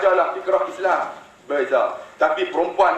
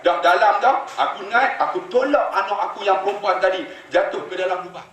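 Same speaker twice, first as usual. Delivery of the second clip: normal talking voice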